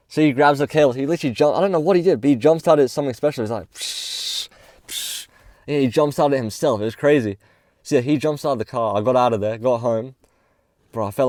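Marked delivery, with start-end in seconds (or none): 3.7-5.3 slow, breathy "psh" spark noise; imitated spark hiss. Stranger jumpstarting the car, imitated spark hiss. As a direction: mimic the cables sparking with a hiss.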